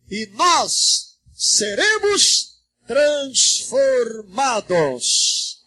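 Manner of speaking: slow, projected proclamation of scripture; solemn scripture reading. Real, not acted. Pastor reading Corinthians to the church, slow solemn proclamation. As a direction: draw out each phrase with long pauses. Reads scripture aloud to congregation.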